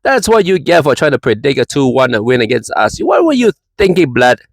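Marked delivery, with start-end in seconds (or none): 3.0-4.4 a hint of the Jamaican taunt voice, higher; light mock Jamaican accent. Vlogger's last taunt at a rival fan, lightly accented. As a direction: slip back into the teasing accent briefly. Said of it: "impersonation of you".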